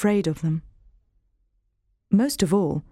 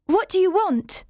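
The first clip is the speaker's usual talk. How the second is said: put-on much higher child's voice, wary question; timid girl asking, pitched up; young Jane asking; 11 semitones higher than usual. Jane faces her cousin, high child's voice. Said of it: "I asked him".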